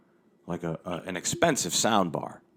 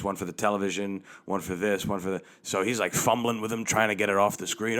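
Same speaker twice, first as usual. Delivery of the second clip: usual speaking voice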